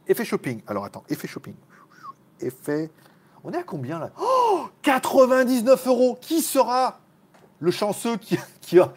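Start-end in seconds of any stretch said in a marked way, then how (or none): 0.7-2.9 whispered aside to himself; whispering. Real, not acted. Muttering to himself. 4.2-8.9 hyped host. Acted. Hyping up a live donation.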